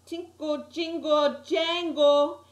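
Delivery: high, growing from normal to loud jingling; building jingle. A narrator's reading. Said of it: "but then it started getting louder".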